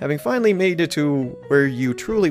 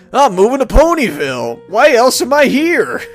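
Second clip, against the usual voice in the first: raised voice, mock-obvious and excited; hammed-up enthusiasm; 9 semitones higher, 6 dB louder than usual. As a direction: proclaim it with playful gusto. Playing the newcomer announcing the move.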